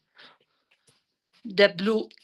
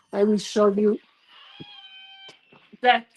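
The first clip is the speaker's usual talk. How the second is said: cold lips, words hard to get out; shivering; 4 semitones higher than usual. Real, not acted. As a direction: speak shivering from the freezing cold. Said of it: "it's difficult to speak because of the cold".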